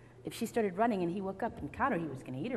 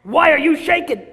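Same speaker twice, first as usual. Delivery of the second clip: high, very loud demand; big bad wolf interrogating loudly; angry wolf shout; 7 semitones higher, 18 dB louder than usual. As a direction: bellow the question at the girl. Wolf confronts caught girl.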